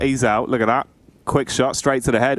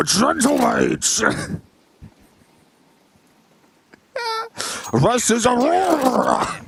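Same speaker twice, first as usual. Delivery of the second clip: higher, slow mock presidential announcer; Richard Nixon impression; 11 semitones higher than usual. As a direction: do a grand old-politician voice for the team intro. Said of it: "it just sounds like Richard Nixon".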